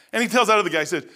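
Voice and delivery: normal talking voice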